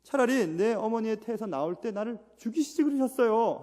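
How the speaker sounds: mock-anguished outcry; Job crying out. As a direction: cry out to God like Job. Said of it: "욥은 외치고 있습니다".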